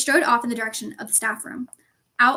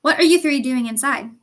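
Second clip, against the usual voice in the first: loud, sudden challenge; ringing voice; 2 semitones higher, 7 dB louder than usual. A narrator's reading. Professor catches three students indoors, ringing voice. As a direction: call out sharply across the hall. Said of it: "a voice suddenly rang across the hall".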